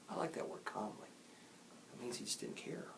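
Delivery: very soft, nearly whispered comment; quiet aside. Real, not acted. Reader explains a word to listeners, hushed. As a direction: drop to a hush to explain.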